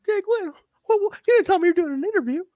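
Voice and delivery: very high, whiny put-on voice; David Young impression. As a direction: play the hapless guy caught unawares, in falsetto. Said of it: "but yeah, David".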